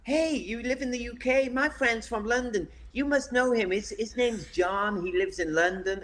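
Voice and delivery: high-pitched, eager American guy; American accent. Retold or imitated. American guy quizzing a Londoner, imitated eager American accent. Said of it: "one guy saying to me once".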